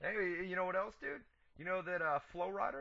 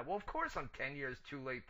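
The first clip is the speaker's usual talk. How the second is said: usual speaking voice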